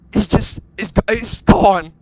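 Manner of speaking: ordinary delivery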